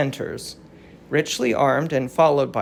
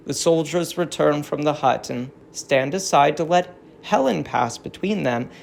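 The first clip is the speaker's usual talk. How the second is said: ordinary delivery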